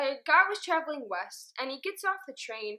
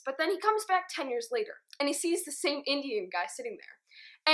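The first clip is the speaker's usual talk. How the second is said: normal talking voice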